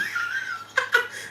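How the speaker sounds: high-pitched giggle; squeaky peal of laughter. Real, not acted. Amused by a comment.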